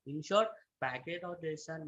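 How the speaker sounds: very soft, hard to hear; speaking too quietly; barely audible. Real, not acted. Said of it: "your voice is very low".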